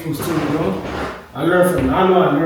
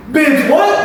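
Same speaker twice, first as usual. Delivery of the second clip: shocked disbelief; 10 semitones higher, 4 dB louder than usual. Retold or imitated. Shocked at wrong test.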